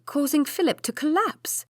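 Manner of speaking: ordinary delivery, nothing marked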